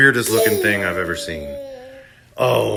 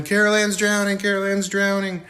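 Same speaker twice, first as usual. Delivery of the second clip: mock screaming; 10 semitones higher than usual. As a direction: imitate frantic screaming, voice way up. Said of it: "screaming, yelling".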